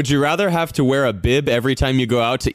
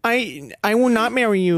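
mock baby voice; 8 semitones higher than usual. Playful mock refusal in voice.

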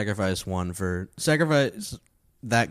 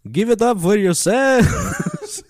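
high, loud, stretched vampire call; milk the applause line as a corny vampire; hammed-up vampire hack comic; Count Hacula; 8 dB louder, much slower than usual. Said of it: "dumb hack shit in a vampire voice".

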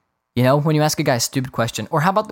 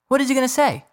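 repeated high and exasperated; 12 semitones higher, faster than usual. Real, not acted. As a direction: throw it up, fed up. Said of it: "Like, what is he gonna say?".

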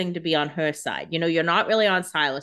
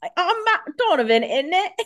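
high, loud mock accent on Matt Donovan; silly failed accent; 9 semitones higher, 5 dB louder than usual. Hosts testing the name Matt, silly failed accent. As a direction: ham up a bad accent, near laughing. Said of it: "hard to say the name Matt in any accent".

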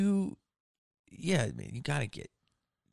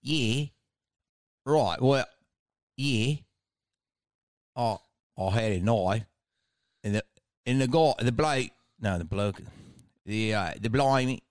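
mock bogan Australian accent; stretched Australian; 3 dB louder than usual. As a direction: stretch every word like a bogan. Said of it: "it's really stretched out".

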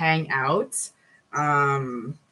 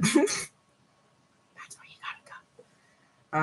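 whisper; 9 semitones higher, 5 dB quieter, much slower than usual. Real, not acted. Teasing sexy invitation.

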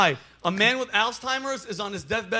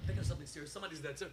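hushed, breathy murmur, much softer; whispering to himself; 7 semitones lower, 19 dB quieter, much faster than usual. Real, not acted. Trying not to laugh, he whispers to himself.

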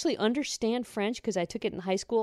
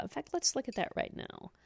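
whisper; 5 semitones higher, 11 dB quieter than usual. Real, not acted. Aside to self checking clock.

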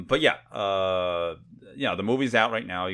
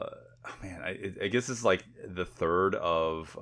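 ordinary talk

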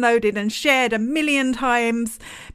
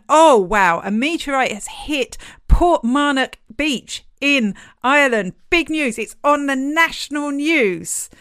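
mock amazement; 5 semitones higher, 5 dB louder than usual. Mock awe at the news hype.

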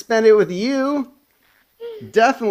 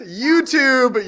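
yelling; 11 semitones higher, 3 dB louder, much slower than usual. Acted. Staged breakup fight.

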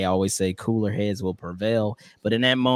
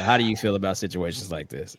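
usual speaking voice

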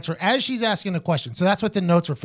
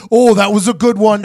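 loud, delighted imitated reaction; put-on voice, raised and appreciative; Michael Cole; 3 semitones higher, 7 dB louder than usual. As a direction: burst out as an amused boss in the earpiece. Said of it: "I hear Michael Cole go".

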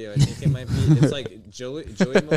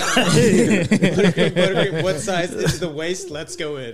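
laughing through a quote; 6 semitones higher, 5 dB louder than usual. Acted. Laughing through a quoted movie line.

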